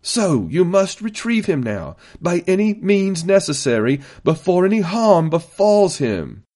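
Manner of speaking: high, insistent, deliberate command; forceful public demand, drawn out. A narrator's reading. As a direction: declaim the order to the whole chamber. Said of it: "Stanisher's voice grew louder again".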